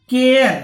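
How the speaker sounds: केयर said loudly with an ए gliding into an आ; air sound demo. Shown as a demonstration. Teacher showing students how to say केयर. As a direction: stress the glide from ए to आ in केयर. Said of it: "ए और आ मिलके केयर बन रहा है".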